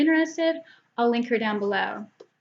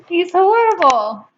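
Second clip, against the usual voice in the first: playful scolding; 7 semitones higher, 9 dB louder than usual. Real, not acted. Cat causing trouble on camera.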